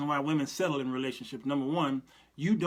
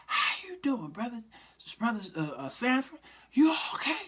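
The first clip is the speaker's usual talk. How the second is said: airy talk; 6 semitones higher than usual. Acted. Greeting a brother at church with bad breath, high and airy. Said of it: "they talk airy".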